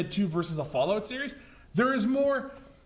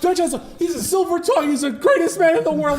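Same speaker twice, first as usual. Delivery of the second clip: hammed-up cracking voice, louder and higher; 8 semitones higher, 7 dB louder than usual. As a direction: gush with a cracking, raised voice. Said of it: "Why is your voice cracking so much?".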